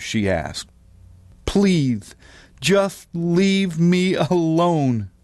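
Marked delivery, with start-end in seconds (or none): 1.5-5.1 higher, drawn-out lisped plea; pleading lisping boy. A narrator's reading. Roy begs Mama from the bathroom, slow lisped plea. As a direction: beg slowly, with th for s.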